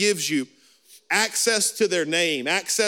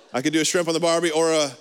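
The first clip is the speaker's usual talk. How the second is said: ordinary delivery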